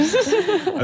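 joking laugh. Pretend. Joking mystical claim, laughing.

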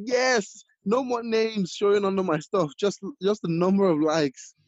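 high-pitched, giddy celebration; thrilled voice squealing over the likes; hyped. Retold or imitated. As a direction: gush like you just went viral. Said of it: "I was so hyped".

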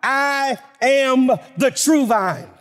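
steady, ringing pulpit delivery, slowing at the end; preaching. Acted. Preacher reciting the I am sayings, ringing proclamation. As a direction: proclaim each verse like a rising list.

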